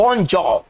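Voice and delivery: bonjour as "bon-JAW"; French pronunciation. Shown as a demonstration. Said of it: "इसका उच्चारण करेंगे".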